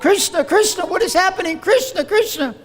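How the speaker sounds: high alarmed wife voice; startled wife calling out; worried wife calling. Retold or imitated. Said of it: "his wife called".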